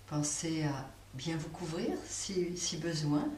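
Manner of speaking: ordinary delivery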